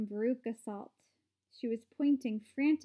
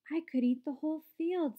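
higher, delighted exclamation; grinning delight; 5 semitones higher than usual. A narrator's reading. Grandpa Joe to Charlie after tasting the grass, grinning delight. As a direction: say it through a delighted grin. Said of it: "grinning with delight".

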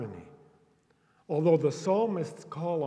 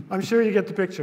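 ordinary talk, nothing marked

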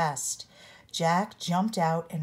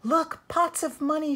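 high and louder, thrilled; excited shout; 9 semitones higher, 4 dB louder than usual. A narrator's reading. Jack spotting the spilled gold, excited shout. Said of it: "shouted Jack".

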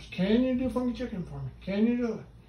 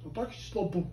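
ordinary talk, nothing marked

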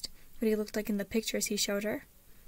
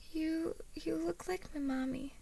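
put-on shy, high child voice; halting, softly voiced small boy; little boy; 7 semitones higher, 4 dB quieter than usual. A narrator's reading. Shy boy speaks to Harriet.

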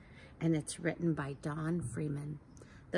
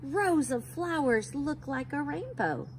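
bright, higher storybook reading; reading to children; 5 semitones higher than usual. A narrator's reading. Teacher reads a rainbow book to kids, lively. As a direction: read it like a picture book to little ones.

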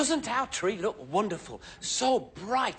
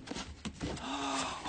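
gasp; 10 dB quieter than usual. Acted. Finds the wrong presents.

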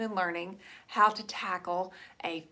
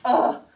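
loud, high "ugh"; disgusted groan; 5 semitones higher, 10 dB louder than usual. Retold or imitated. Recalling her boring morning look at it, loud dismayed groan.

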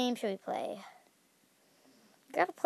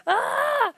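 high, loud hammed-up shriek; mock terrified scream; 13 semitones higher, 5 dB louder than usual. Gamer fleeing the evil Mario balls, hammed-up scream. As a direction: scream like a horror victim.

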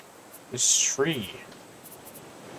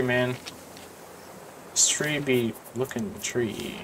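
normal talking voice

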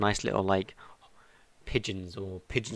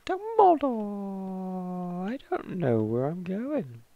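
long drawn-out high call, slow; Dumbledore call; 9 semitones higher, much slower than usual. Pretend. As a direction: wail the name like a mock summons. Calling out as a joke.

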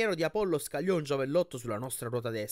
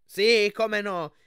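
loud, drawn-out scoffing yell; slow sarcastic shout, pitched up; shouting back; 8 semitones higher, 10 dB louder, much slower than usual. A narrator's reading. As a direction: hurl it back at a rival, high and very loud. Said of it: "gridò Annabeth di rimando".

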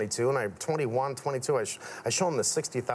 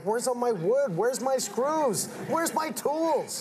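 imitating dad's high, rising demands; high-pitched mock parental complaint, drawn out at the end; exasperated dad; 10 semitones higher, slower than usual. Dad hunting for his missing supplies, exasperated. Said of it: "My dad thought I was a freak. He's like".

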